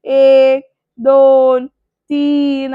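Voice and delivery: loud, drawn-out count to three; threatening countdown. Retold or imitated. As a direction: imitate a father counting as a warning.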